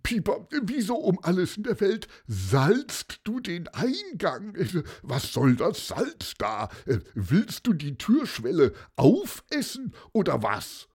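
high, bewildered questioning; baffled. Acted. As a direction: Paulus utterly confused by the salt. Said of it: "verstand so langsam gar nichts mehr".

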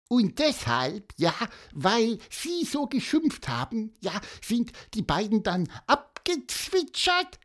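very high, squeaky bird voice; chirping little bird. Acted. Little bird guessing why the children ran off, piping. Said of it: "piepste Pieper".

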